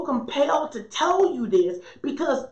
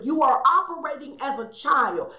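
ordinary talk, nothing marked

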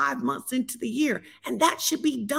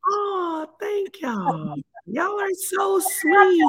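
touched exclaim; 11 semitones higher, much slower than usual. Real, not acted. Touched reaction to greeting.

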